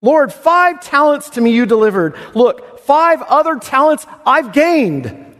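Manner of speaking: high, bright with overjoyed pride; the overjoyed servant presents his doubled talents to the master; ecstatic servant. Acted. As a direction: burst out like a servant thrilled with his gain. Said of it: "He's ecstatic".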